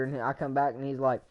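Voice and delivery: ordinary delivery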